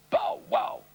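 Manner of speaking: shouted bow wow bark; dog bark. Retold or imitated. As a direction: bark it loud.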